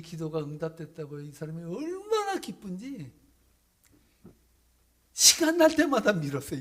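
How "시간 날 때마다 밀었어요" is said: high, loud burst; eager pushing. Acted. Preacher to the church, loudly excited about the pushing. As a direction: shout out how hard he pushed.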